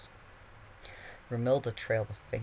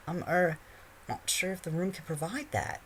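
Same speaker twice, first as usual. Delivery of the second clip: put-on raised nervous teen boy; hesitant boy voice; 8 semitones higher than usual. A narrator's reading. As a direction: hedge awkwardly. Harry hesitant.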